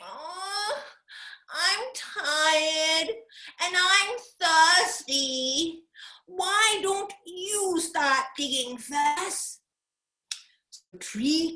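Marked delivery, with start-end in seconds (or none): 0.0-9.6 higher, slow whiny complaining; whining koala. Acted. Koala whining to tree kangaroo to dig first. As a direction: drag it out like a lazy whiner. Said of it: "Koala started to whine".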